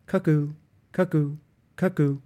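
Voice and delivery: high, loud sing-song cuckoo calls, each falling; cuckoo clock call. A narrator's reading. The mantelpiece clock cuckooing the hour, high loud bird call.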